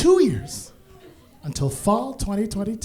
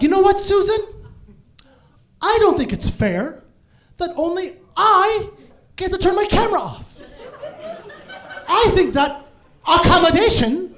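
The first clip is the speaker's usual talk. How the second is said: much higher, louder indignant speech; righteous rage; 10 semitones higher, 5 dB louder than usual. Retold or imitated. Employee protesting to the manager, mock righteous rage. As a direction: demand it with mock outrage. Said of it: "righteous rage".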